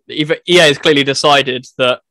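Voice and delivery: ordinary delivery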